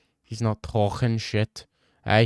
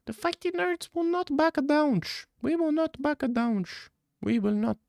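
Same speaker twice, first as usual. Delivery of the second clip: much higher put-on voice, hammed-up defiance; mock battle cry. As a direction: squeak it out like a mock rallying cry. Mock chant for the gym.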